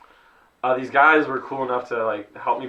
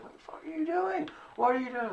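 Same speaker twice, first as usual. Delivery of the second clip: much higher mock yell of an annoyed driver; mock angry driver; 8 dB quieter than usual. Honking drivers yell at the stalled truck, mock angry. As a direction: squawk like a fed-up driver stuck behind you. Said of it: "people are honking, like".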